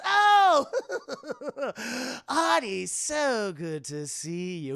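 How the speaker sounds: gleeful villain, high cackle into slow drawl; theatrical villain greeting with a cackle; Joker. Acted. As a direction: burst out high, then stretch the words menacingly. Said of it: "It's the Joker".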